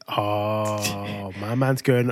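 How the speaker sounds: usual speaking voice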